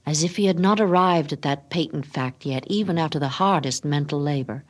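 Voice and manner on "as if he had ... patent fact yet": ordinary delivery, nothing marked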